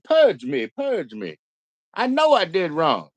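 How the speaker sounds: high, hammed-up begging for forgiveness; mock penitent plea. Mocking Daniel begging the audience to purge him, high and pleading. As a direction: beg like a sinner before a crowd. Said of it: "Daniel is an admitted sinner".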